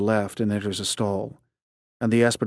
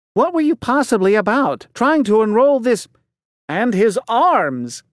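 raised, outraged question, slowing on the end; indignant dean; 10 semitones higher, 4 dB louder than usual. Acted. Dean confronting Tavis over enrolling the boy, outraged. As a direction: demand it in disbelief.

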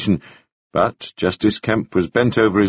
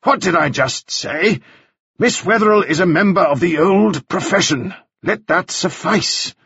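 higher, slowing to a firm close on suffice; stern rebuke; 9 semitones higher than usual. Acted. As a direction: scold the lawyer and close the matter. Justice rebukes Broom.

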